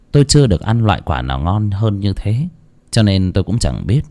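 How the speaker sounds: plain reading voice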